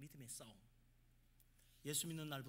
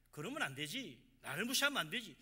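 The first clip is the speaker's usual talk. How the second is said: acted reproach, getting louder; scolding boss; 2 semitones higher, 8 dB louder than usual. Boss to employee at the company dinner, indignant scolding. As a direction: scold as a slighted superior, building in force.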